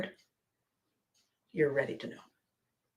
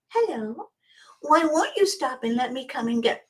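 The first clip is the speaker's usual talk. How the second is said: higher, sweet mock puppy talk; 6 semitones higher than usual. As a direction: coo at the garbage men like they're a puppy. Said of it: "the tone that you used with the puppy".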